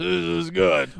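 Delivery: blissed-out groan into the words; savouring moan. Retold or imitated. Retelling the diner's first bite, savouring moan. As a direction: moan it out over a perfect bite.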